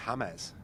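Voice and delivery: "James" said Spanish-style as HA-mez; Spanish-style name. Retold or imitated. Man repeats his friend's nickname for him, Spanish-style "James". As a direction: say "James" with an h and two syllables, HA-mez.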